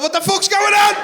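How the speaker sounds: very high, loud bewildered cry; hammed-up squawk of disbelief; alarmed shout. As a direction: yell it in comic panic. Acted alarm in the supermarket.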